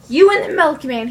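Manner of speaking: jealous accusation. Retold or imitated. Jealous husband accusing his wife about the milkman, loud. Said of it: "he said".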